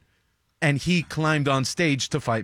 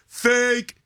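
sharp high mocking shout of fake; heckler yell; 11 semitones higher, 3 dB louder than usual. Heckler yells during comedy set.

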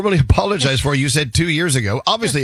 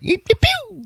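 tiny squeaky sneezes; church mouse sneeze; slower than usual. Shown as a demonstration. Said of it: "what would a church mouse sneezing sound like?".

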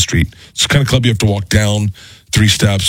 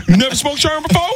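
much higher, rising, mocking Tracy voice; Tracy Morgan impression. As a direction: push it way up like Tracy pushing the joke. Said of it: "He's like".

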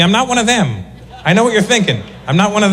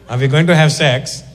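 put-on Indian accent, last word drawn way out; coaxing Indian newlywed; much slower than usual. Indian newlywed on wedding night, coaxing in Indian accent. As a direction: coax in an Indian accent, stretching the repeated word. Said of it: "You can only imagine an arranged marriage".

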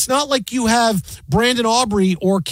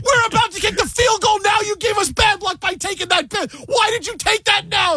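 much higher mock yell, blaming him; angry fan yelling. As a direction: yell at the guy for the bad luck. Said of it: "He goes".

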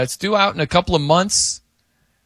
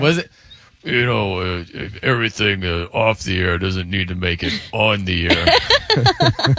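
Coop impression; 6 semitones lower than usual. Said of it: "let me do my Coop impression".

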